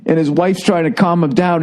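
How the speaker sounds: ordinary delivery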